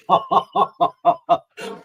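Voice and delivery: breathless high laugh in disbelief; airy stunned laugh. Real, not acted. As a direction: laugh it off in shock. Laughing at the revelation.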